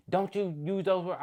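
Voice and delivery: high, mocking reprimand; mock snapping. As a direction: imitate an upset mother telling you off. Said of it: "So she trying to snap on me".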